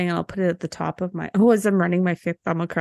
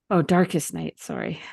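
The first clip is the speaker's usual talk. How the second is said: normal talking voice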